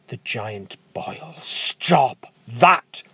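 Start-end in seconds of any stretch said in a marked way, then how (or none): 1.4-3.0 giant's order. Acted. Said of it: "he orders".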